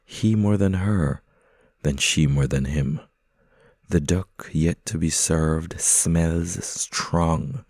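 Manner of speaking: plain reading voice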